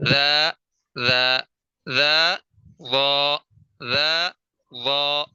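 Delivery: "ذا" thin and light, "وا" heavy and full-mouthed; thin versus heavy letters. Shown as a demonstration. Tajweed teacher drills the contrast for students. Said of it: "ذال کو باریک کر کے پڑھنا ہے اور وا کو پر کر کے".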